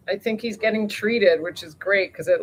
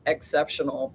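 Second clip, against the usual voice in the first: ordinary delivery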